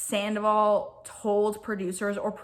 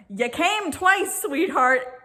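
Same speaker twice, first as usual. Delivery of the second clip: slow, higher, drawn-out smug jab; smug taunt; 5 semitones higher, 4 dB louder, much slower than usual. Retold or imitated. Retelling Jax's slow smug taunt to Kristen. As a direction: savour every word of the insult. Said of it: "He's like".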